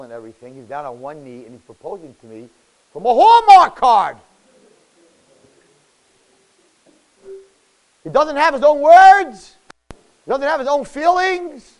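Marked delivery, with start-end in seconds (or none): 2.9-11.7 loud, high, drawn-out outrage; acted exasperated outburst; mock indignation. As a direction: cry out in disbelief at the card. Acted indignation at card proposal.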